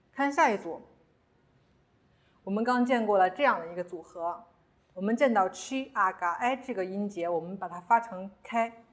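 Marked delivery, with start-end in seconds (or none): none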